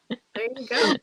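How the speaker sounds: soft breathy echo; whisper. Shown as a demonstration. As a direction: whisper it back. Said twice, second whispered.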